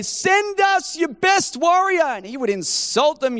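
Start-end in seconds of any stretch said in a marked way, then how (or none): 0.0-2.0 high, slow mock giant's taunt; hammed-up challenge from a giant, higher and slow; a giant calling out the enemy army, drawn out; Goliath's taunt. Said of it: "he'd taunt Israel and he'd say".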